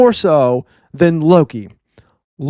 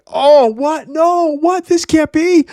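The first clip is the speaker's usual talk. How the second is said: much higher, squeaky disbelieving voice; shocked disbelief; 12 semitones higher, much slower than usual. Retold or imitated. Road stunned by Gerd's new respect, much higher. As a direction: gasp it out, overwhelmed with joy. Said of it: "He's like".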